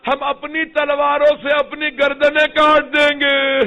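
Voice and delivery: slow, high, full-voiced vow; Habib's loud pledge to Zainab, slowly declaimed; calling out loud. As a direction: cry it out as a vow of loyalty. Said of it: "آواز دی".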